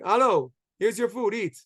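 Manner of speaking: imitating a curt, gruff greeting; gruff and blunt. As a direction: play a blunt, brusque son. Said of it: "Very, like, gruff, very blunt".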